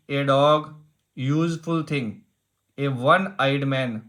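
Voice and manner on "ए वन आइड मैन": ordinary delivery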